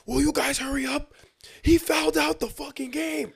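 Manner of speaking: high-pitched, exasperated coach outburst; retold irate coach. Coach demanding they hurry up, mock irate. Retells coach yelling at team.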